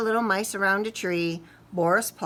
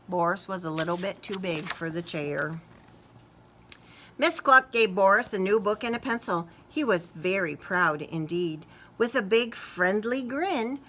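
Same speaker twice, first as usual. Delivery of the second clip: ordinary delivery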